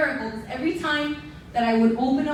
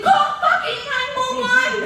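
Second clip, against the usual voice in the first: high, loud frantic order; frantic yell; 10 semitones higher, 5 dB louder than usual. Retold or imitated. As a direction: shriek the command at dad. Screaming at dad to call.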